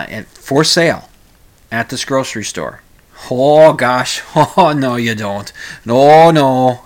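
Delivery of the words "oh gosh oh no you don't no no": put-on loud Minnesota accent with drawn-out vowels; mock dismay in a big Minnesota accent. Host objecting to liquor in a grocery store, Minnesota accent. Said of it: "break out the Minnesota accent".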